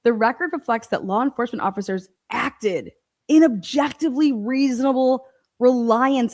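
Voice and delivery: normal talking voice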